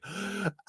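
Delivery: sharp high frightened gasp; mock avoidant's shocked intake of breath; startled gasp. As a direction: gasp like you've been cornered. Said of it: "they're like".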